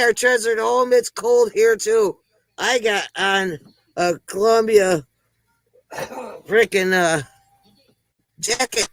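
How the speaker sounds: ordinary talk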